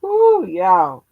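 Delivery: ordinary talk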